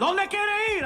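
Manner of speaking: high-pitched mock yell in Spanish; yelling cab driver. Comic plays Cuban driver asking where to go, mock yelling. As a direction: imitate a driver hollering at passengers. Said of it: "So he's yelling at us".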